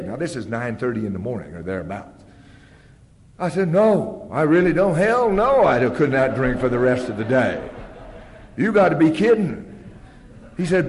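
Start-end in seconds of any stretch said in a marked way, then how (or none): 3.7-9.7 loud, high-pitched protest; indignant refusal. Retold or imitated. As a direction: refuse outright, incredulous at being asked. Indignant refusal in retold argument.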